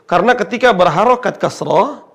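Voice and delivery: normal talking voice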